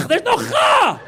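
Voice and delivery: high, loud mock correction; shouted mock correction. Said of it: "you start going".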